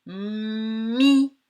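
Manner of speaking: "Mi" with a long held m opening into i; stretched sounds. Shown as a demonstration. Teacher showing mouth movements, "Mi" slowly stretched. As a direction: hum into the m of "Mi", then open to i. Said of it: "est-ce que ma bouche fait plusieurs gestes?".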